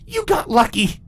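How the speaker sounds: bitter angry snap; spat. Acted. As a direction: spit the words at her. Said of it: "Twilight spat".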